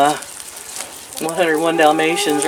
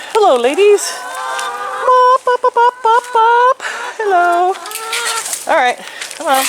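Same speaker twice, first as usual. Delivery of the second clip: cooing high-pitched greeting to pet hens. Real, not acted. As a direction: coo at the chickens like babies.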